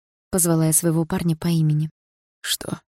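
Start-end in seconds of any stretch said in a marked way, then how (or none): 2.4-2.8 soft whispered question. A narrator's reading. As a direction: barely breathe the word. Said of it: "прошептал он". (тихим шёпотом)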